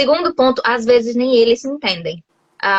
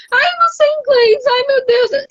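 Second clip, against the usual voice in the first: high squeaky mock panic, far above her own voice. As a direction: whine it like a flustered tourist. Mocking panicked foreigners.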